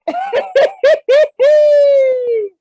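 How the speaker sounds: very high, loud cackle of delight; high-pitched, excited cackling; shrieking laugh. Real, not acted. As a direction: burst into a squealing laugh, stretched out at the end. Reacting to a surprise in the manga.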